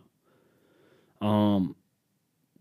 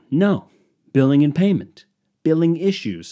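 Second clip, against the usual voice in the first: louder, higher insistent answer to a robot; exasperated caller; 6 semitones higher, 5 dB louder than usual. Retold or imitated. Caller correcting a phone robot, exasperated and loud. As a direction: hit each word at a dense phone menu.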